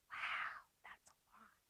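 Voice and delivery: hushed wow, almost no voice; whispered aside. Real, not acted.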